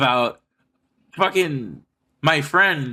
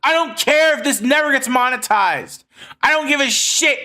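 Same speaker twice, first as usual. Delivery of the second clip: much higher, fierce and defiant; impassioned vow; 8 semitones higher, 3 dB louder than usual. Real, not acted. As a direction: swear it like nothing can stop you. Fired-up vow to keep going.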